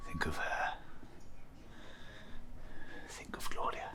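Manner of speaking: hushed whisper to himself. Acted. Victor coaching himself through pain in a whisper. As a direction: whisper it to steady yourself.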